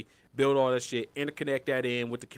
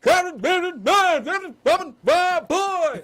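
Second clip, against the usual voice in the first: screamed high and loud, whooping; wild party yell; 12 dB louder, slower than usual. Pretend. Mocking his own single self, whooping to friends. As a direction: let rip like a wild party animal.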